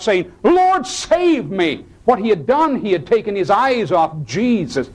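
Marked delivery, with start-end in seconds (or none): 0.4-1.8 high, slow desperate cry. Retold or imitated. Pastor voices sinking Peter calling to Jesus, high desperate cry. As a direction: plead like a sinking man. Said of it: "he cried out".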